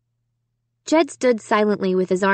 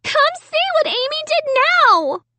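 put-on very high, outraged child's shout. A narrator's reading. Sister telling on Amy to parents, shouting high. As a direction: shout it high to summon everyone. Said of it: "Sarah was shouting".

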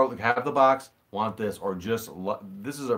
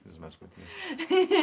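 high teasing voice; much slower than usual. Pretend. Teasing after fake I love you.

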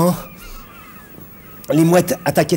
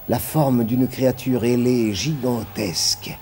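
plain reading voice

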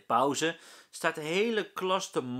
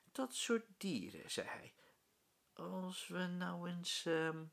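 soft, slow scheming; hushed plotting; 6 semitones higher, 7 dB quieter, slower than usual. A narrator's reading. Rolf plots with friends, soft and slow. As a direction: whisper the scheme.

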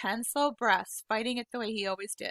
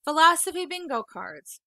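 mildly insulted; 6 semitones higher, 7 dB louder than usual. Acted. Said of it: "She looked only mildly insulted".

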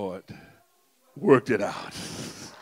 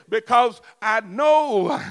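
slow shout; 10 semitones higher, 17 dB louder, much slower than usual. Shown as a demonstration. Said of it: "I said".

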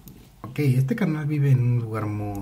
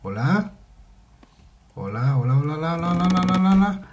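repeated call climbing steadily higher and louder; nervous calling out; 6 semitones higher, 4 dB louder, faster than usual. Real, not acted. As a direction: wind the greeting up higher with every repeat. Calling out repeatedly in horror game.

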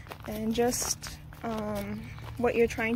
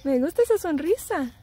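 delighted praise; 7 semitones higher, 4 dB louder than usual. Real, not acted. Mom praising her daughter's smile during the shoot, high delighted coo. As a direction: gush over her smile.

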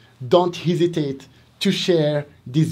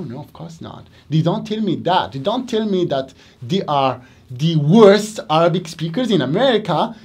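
normal talking voice

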